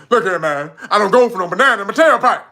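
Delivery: loud, quick, laughing Eddie Murphy quote; Eddie Murphy style cop voice. Retold or imitated. Streamer quotes Eddie Murphy, cocky and laughing. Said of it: "You gotta say it like him".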